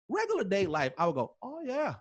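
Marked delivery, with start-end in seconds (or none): 1.4-1.9 high, falling catchphrase delivery; catchphrase imitation. Host imitating the Kev on Stage catchphrase, playful. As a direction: say it like the show's bit.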